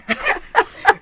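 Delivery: high loud burst of laughter. Real, not acted. Cohost laughing loudly at her own joke.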